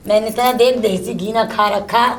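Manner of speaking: usual speaking voice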